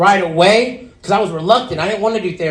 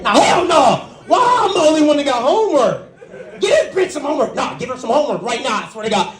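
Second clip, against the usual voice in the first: much higher, shouted, fast indignant complaint; outraged protest; 6 semitones higher, faster than usual. Retold or imitated. Outraged at being given homework.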